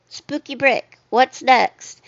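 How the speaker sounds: usual speaking voice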